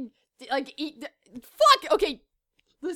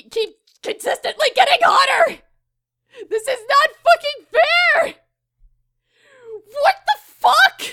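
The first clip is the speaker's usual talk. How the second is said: high, loud exasperated yelling; mock outrage; 4 semitones higher, 5 dB louder than usual. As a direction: explode at the unfairness for laughs.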